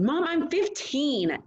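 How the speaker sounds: put-on exasperated girl whining at her mom; teen protest. A narrator's reading. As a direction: drag it out like an indignant teen.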